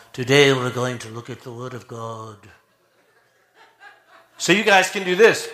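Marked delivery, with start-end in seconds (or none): 0.1-2.4 lower, softer, mellow pulpit voice; calm them down like a formal sermon; mellow preaching voice. Shown as a demonstration. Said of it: "I'll put my preaching voice on".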